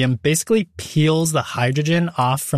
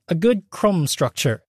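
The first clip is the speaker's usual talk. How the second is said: put-on terrible British accent; 3 semitones higher than usual. Host quoting a British baking show judge, terrible British accent. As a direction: attempt a British baker's accent, badly. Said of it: "That was an attempt at a British accent".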